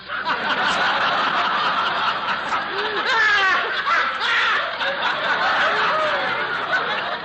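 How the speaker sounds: long, high belly laugh; uproarious laughter. Acted. Announcer cracking up at a joke on his boss, uproarious laughter. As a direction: laugh on and on at your own joke. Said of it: "a man laugh himself out of a job".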